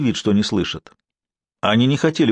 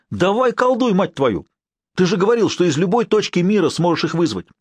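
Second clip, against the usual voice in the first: angry yell; 7 semitones higher than usual. Acted. Boris furiously shaking Sher.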